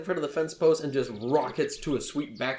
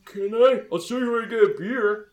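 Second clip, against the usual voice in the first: loud, higher oafish eagerness; bumbling fellow; 8 semitones higher, 5 dB louder than usual. Acted. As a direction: plead like an excited big lummox. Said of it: "he looks forward at you guys and he says".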